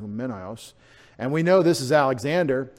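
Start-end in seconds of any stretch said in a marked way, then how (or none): 0.0-0.7 Humenios low and soft, stressed on MEN; lower, softer "Humenios", accent on the E. Shown as a demonstration. Preacher repeats the accented name, stress on E. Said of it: "The E gets the accent".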